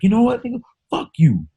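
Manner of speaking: high put-on voice snapping back; hammed-up customer telling the clerk off; mock angry customer. Customer cursing at the store clerk, high mock voice. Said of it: "He was like".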